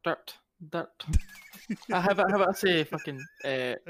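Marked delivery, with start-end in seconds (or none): 0.0-0.9 dirt in a Scottish accent, r barely there; short Scottish dirt, sounding near dot. Shown as a demonstration. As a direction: demonstrate your own Scottish dirt. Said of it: "It's that Scottish accent that does it".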